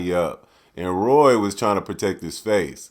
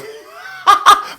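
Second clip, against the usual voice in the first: airy laugh; 6 dB louder than usual. Real, not acted. Joking about new Yeezys.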